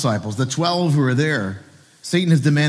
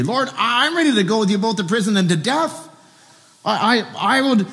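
much higher, heated vow of loyalty; Peter's anger; 8 semitones higher than usual. Retold or imitated. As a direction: swear it at Jesus with wounded anger. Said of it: "Peter's anger towards Jesus".

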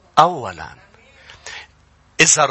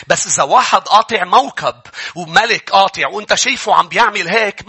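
normal talking voice

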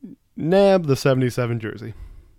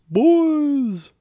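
much higher, loud coach call; Coach Saylor impression; 5 dB louder than usual. Calling the boys in a hammed-up coach voice. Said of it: "bad Coach Saylor impression".

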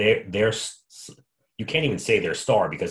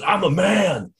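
mock angry coach; 11 semitones higher, 4 dB louder, slower than usual. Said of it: "he goes".

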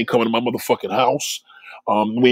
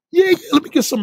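much higher, keen, grabbing the offer; eager customer; 13 semitones higher, faster than usual. Retold or imitated. Man at the counter eagerly accepts extra sauce, high and keen. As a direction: play an over-eager guy ordering. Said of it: "You be like".

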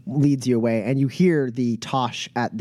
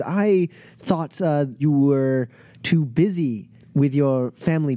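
higher, smooth flatterer; charming Pep; 4 semitones higher than usual. Acted. Pep asking Elkiri what brought her here, charming. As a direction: flatter her while fishing for information.

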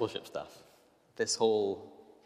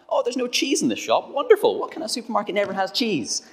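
much higher, louder, sarcastic squeal of disbelief; exasperated sarcasm; 10 dB louder than usual. Retold or imitated. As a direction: squeak out the outrage over the missing cheese.

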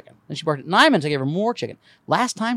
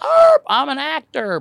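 slow, louder dog bark into a boast; 9 semitones higher, 5 dB louder, slower than usual. Retold or imitated. Comic half-barks a boast as the impatient dog. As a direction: bark the words like a proud dog. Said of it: "that seven-minute bark".

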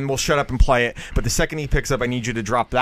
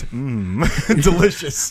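teasing "mm, delicious" imitation; hammed-up yummy sound; mock relish; much slower than usual. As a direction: savor it in mockery, laughing. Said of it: "For him, he's like this".

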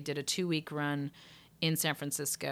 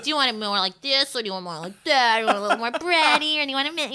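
demo voices; 9 semitones higher, 10 dB louder, faster than usual. Shown as a demonstration.

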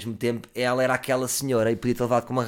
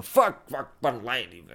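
put-on drunk swearing; slower than usual. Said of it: "Só que o maluco sempre ali".

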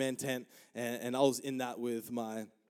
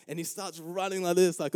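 ordinary talk

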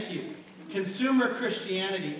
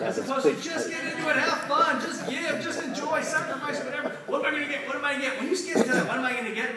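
higher, insistent mock self-interest; pitched-up, impatient imitation; demanding consumer; 5 semitones higher, 3 dB louder, faster than usual. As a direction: rattle off a taker's selfish thoughts. Mimics a self-centred consumer's thoughts.

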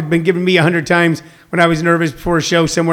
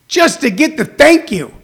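usual speaking voice

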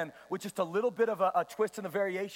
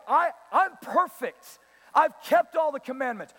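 louder, higher self-satisfied claim; mock boasting; 4 semitones higher, 6 dB louder than usual. Rich young ruler boasting to Jesus, mock self-assurance. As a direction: declare it with hammed-up self-assurance.

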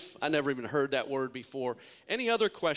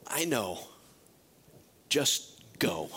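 God speaking; 5 semitones lower, slower than usual. Acted. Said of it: "God was saying".

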